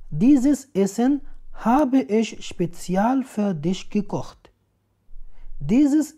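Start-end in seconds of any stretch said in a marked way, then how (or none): none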